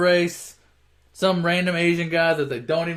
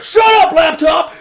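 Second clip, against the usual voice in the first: very high, loud imitated yell; mock angry shout; 11 semitones higher, 9 dB louder, slower than usual. Driver snaps at his laptop, high mock shout. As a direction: yell it back, voice leaping up.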